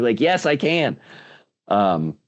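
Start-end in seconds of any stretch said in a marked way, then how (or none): 0.2-1.0 "Yes, I can" pitched higher, with conviction; like you mean it. Retold or imitated. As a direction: say it like you finally believe it. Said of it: "you gotta say it like you mean it".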